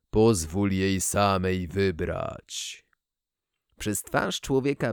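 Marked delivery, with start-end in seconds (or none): 0.1-2.8 slow, low, whispered mechanical voice; whispering synthesizer. Acted. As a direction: breathe it out like a secret oracle. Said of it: "włączyłem syntezator mowy".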